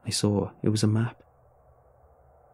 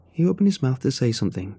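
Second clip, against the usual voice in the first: plain reading voice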